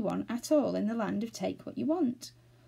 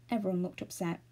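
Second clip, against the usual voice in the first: usual speaking voice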